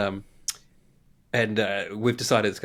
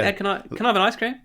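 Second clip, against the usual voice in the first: imitating eager child; 9 semitones higher, faster than usual.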